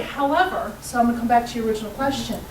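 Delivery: usual speaking voice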